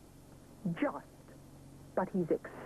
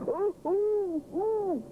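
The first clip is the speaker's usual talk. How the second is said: alarmed wobble; 13 semitones higher, 5 dB louder, much slower than usual. A narrator's reading. Elephant nearly falls.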